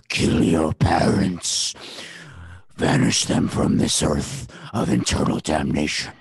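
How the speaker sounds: slow, deep, low growling demon. Acted. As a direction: drag it out like a voice from hell. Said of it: "It's my demon voice".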